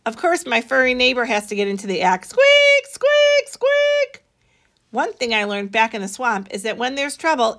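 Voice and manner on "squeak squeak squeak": high, loud squeaking hamster; a hamster squeaking into the argument; hamster squeaks. Acted. Hamster joining the act.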